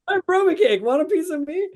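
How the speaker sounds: very high playful challenge; quoted show line. Acted. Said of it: "one of my favorite lines".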